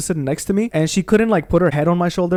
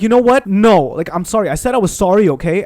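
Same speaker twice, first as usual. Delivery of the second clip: louder, higher mock outburst; exasperated retort; 3 semitones higher, 4 dB louder than usual. Partner fends off demands for an explanation, imitated exasperation. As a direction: imitate a fed-up partner, pushing the volume up. Retold partner snapping back in quarrel.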